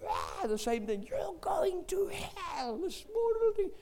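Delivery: put-on very high, slow whisper of the child's warning; mock whisper of the son's warning; whispered retelling. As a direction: hiss the threat like a tiny voice. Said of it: "he had a shrieking voice".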